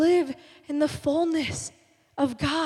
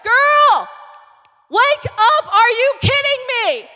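high, loud scolding shout; exasperated Lord; 9 semitones higher, 6 dB louder, slower than usual. Acted. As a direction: shout it like a fed-up wake-up call. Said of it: "the Lord was like".

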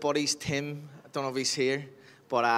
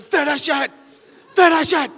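coach yelling; 11 semitones higher, 7 dB louder than usual. Retold or imitated. Coach yelling at exhausted athletes.

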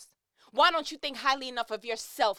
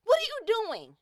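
much higher, louder, shaking someone awake; shaken shout; 11 semitones higher, 4 dB louder than usual. Retold or imitated. Shaking a stuck friend by the shoulders, shouting. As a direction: yell it at them. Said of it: "shake you by the shoulders and say".

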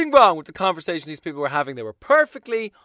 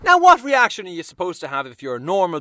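ordinary talk, nothing marked

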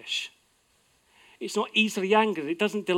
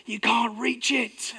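slow, higher, teasing sing-song; playful tease; 6 semitones higher, slower than usual. Retold or imitated. Dad playfully teasing kids who can't reach, slow and higher. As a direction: gloat gently, like winning a game.

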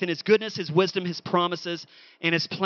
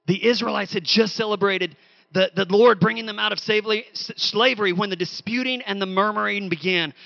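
usual speaking voice

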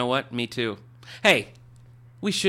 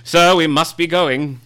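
high, loud, pompous gentleman voice; grand self-important literary heir; Christopher Tolkien voice; 5 semitones higher, 10 dB louder, slower than usual. Acted. Holds the Tolkien character voice.

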